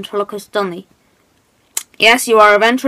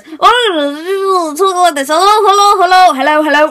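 raised, strained puppet voice; cut-off voice; 5 semitones higher than usual. Shown as a demonstration. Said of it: "I'm gonna cut my voice and just go like this".

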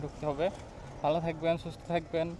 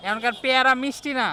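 calling out; 10 semitones higher, 5 dB louder than usual. Real, not acted. Loud remark to vendor.

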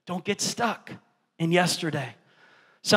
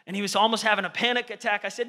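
ordinary talk

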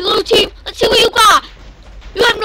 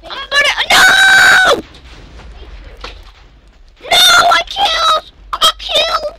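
anguished shout; 9 semitones higher than usual. Real, not acted. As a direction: scream your defeat at the game. Killed in game, crying out.

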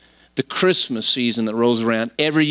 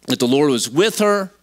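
usual speaking voice

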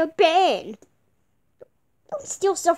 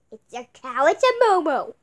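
normal talking voice

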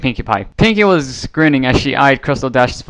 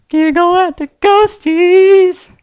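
very high, slow, drawn-out singsong; eerie high pony taunt; mad Pinkie; 4 dB louder, much slower than usual. A narrator's reading. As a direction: stretch it out like a crazed child. Narrator voices Pinkie Pie.

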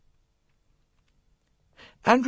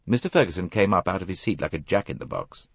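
ordinary delivery

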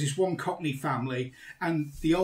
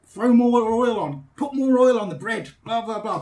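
urging shout; 7 semitones higher, 9 dB louder than usual. Retold or imitated. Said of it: "kept trying to encourage him".

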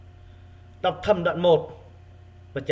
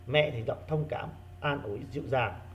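gentle, consoling; 3 semitones lower, 7 dB quieter than usual. Shown as a demonstration.

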